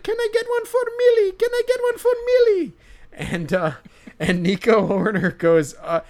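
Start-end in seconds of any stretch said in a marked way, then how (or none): 0.0-2.7 put-on very high, loud squeaky old lady. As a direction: squeak it out like an eager granny. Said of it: "And she's like".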